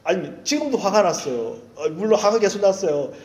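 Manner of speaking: ordinary talk, nothing marked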